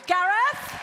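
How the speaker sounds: high, loud questioning call. Pretend. Host looks for the maestro, calling out questioningly. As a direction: call up with a rising lilt.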